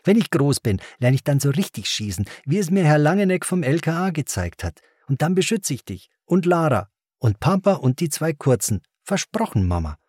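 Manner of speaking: put-on high-pitched, eager child; excited boy. A narrator's reading. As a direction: explain it all with a kid's excitement. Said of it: "erklärte Ben aufgeregt".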